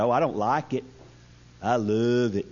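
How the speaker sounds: low, lightly voiced old codger; a hint of sly old-timer relish. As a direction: savor it like a mischievous old man. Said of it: "this is how he said it".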